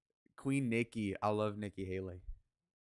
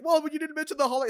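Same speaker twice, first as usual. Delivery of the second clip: much higher, louder, fast outrage; shrill outraged critic; 10 dB louder, faster than usual. Retold or imitated. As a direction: squeal it like an offended scold. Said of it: "they'd be like".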